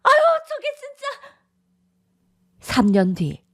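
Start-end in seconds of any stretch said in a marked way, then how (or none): 0.0-1.4 high, sharp call after a son storming off; calling after him. Acted. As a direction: yell his name as he leaves.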